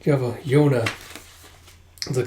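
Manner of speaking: normal talking voice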